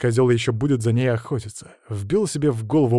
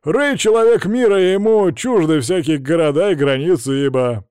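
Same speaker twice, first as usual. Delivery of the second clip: loud, high, declaiming voice; grandiose driver; 5 semitones higher, 5 dB louder than usual. A narrator's reading. Driver boasts he is a man of the world, grandiose.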